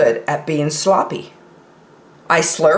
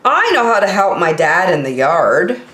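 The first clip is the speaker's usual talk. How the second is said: ordinary delivery, nothing marked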